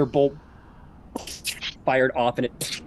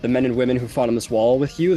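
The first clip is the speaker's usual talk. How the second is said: ordinary talk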